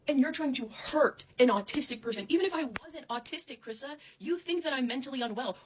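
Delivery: loud, high, furious; angry yelling. Real, not acted. Confronting Krissa about hurting her, shouting. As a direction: shout the accusation. Angry rant at former friend.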